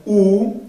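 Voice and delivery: "où" as a long oo; French "où", pure oo; U sound. Shown as a demonstration. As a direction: say "où" like Spanish U. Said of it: "siempre se va a pronunciar U".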